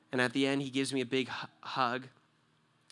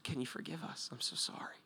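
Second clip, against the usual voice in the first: hushed apology; 7 dB quieter than usual. Retold or imitated. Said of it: "Because I just said".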